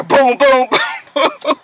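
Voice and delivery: mock gun booms with a laugh; imitated gunshots. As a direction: mouth the gun going off.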